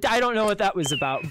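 ordinary delivery

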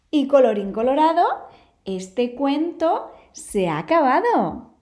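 storybook ending. A narrator's reading. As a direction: close the tale like reading to children. Storybook closing formula.

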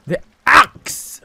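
high, loud exclamation after a gasp; gasp, then cry out the discovery; excited realization. Real, not acted. Player realizing how to cut the tree, excited realization.

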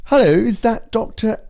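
high, far-up-the-nose royal English telephone voice; posh Queen's English. Shown as a demonstration. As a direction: put on a Queen's English sales-call voice. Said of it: "a more royal, uh, Queen's English".

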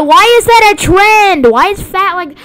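loud, high-pitched outraged questioning; exasperated yell. Real, not acted. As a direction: cry out in disbelief at a silly trend. Exasperated by an avatar trend.

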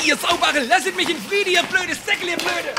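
high, furious Swabian ranting; angry cursing. Acted. German suitor being arrested, cursing the guards in a high, furious Swabian dialect. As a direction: curse them out while being dragged away.

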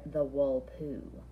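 low, very slow spooky build-up; ominous villain name. Acted. Teasing the kids with the evil twin's name, low and slow. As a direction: make the name sound menacing, drawn out.

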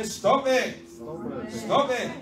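acted sharp order; emphatic rebuke. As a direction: call it out to the room. Jesus voiced, congregation to repeat.

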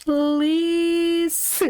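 "Лис" very drawn out on a high held И; blending letters. Shown as a demonstration. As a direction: stretch "Лис", then add the С. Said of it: "І додаємо букву С". (протяжне «Лис» для читання)

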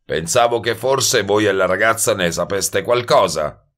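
impatient wise guy. Acted. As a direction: insist impatiently, leaning hard on the first words. Voices Goebel, impatient with narrator. (sbruffone impaziente e insistente)

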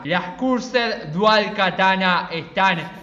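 low, very slow, solemn proclamation; deep, ominous delivery with weighty pauses; epic dramatic narration. Acted. Gamer unveiling the cursed katana against K-Queen, low ominous proclamation.

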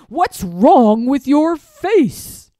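loud, slow, lord's villain voice; villain's accusation. Acted. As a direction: drag it out as a menacing accusation. Villain lord confronts boy.

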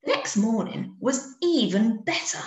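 plain reading voice

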